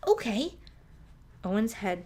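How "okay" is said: high, eager little okay; mouse's squeaky voice. A narrator's reading. Little mouse agreeing to the rescue, high and eager. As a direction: chirp it out high. Sophie voice.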